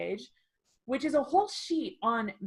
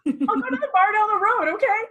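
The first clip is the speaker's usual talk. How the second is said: 1 mock huffy retort; 12 semitones higher, 9 dB louder, faster than usual. Imagined customer storming off.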